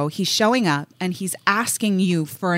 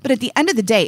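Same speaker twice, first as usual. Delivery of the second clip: ordinary talk, nothing marked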